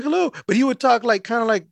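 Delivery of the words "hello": imitating a high-voiced customer; short, high mock greeting; high-pitched voice. Mail carrier showing how his customer said hello, high-pitched. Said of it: "That's how he would sound".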